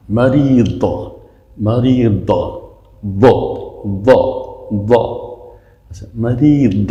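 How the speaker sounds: "مریض" ending on a heavy d from the side of the tongue; dad from tongue side. Shown as a demonstration. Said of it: "باد از بغل زبان". («مریض» با ضاد از بغل زبان)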